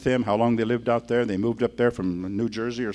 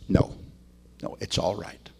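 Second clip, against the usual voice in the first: soft hushed reply; 6 semitones lower, 6 dB quieter than usual. Retold or imitated. Dad quietly declines questions.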